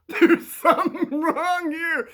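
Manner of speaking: high, loud, slow words through laughter; cracking up. Real, not acted. Playing the kid who spots something wrong, cracking up. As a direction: laugh helplessly while voicing the line. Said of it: "trying to keep a straight face".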